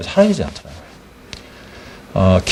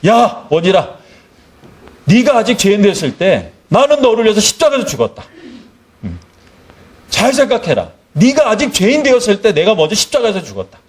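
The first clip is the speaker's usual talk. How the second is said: high-pitched, earnest divine rebuke; admonishing Lord; 7 semitones higher than usual. Retold or imitated. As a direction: play the Lord calling a servant to think. Voices Jesus admonishing the preacher. (높은 음으로 타이르는 주님의 말투)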